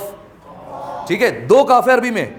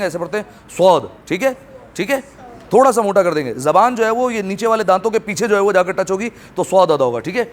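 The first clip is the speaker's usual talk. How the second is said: ordinary delivery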